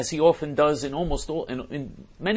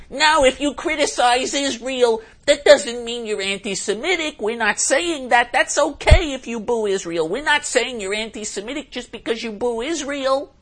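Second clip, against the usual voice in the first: mocking ADL spokesman; 11 semitones higher, 6 dB louder than usual. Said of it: "how the A D L and aipac speak".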